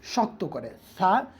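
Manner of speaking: ordinary talk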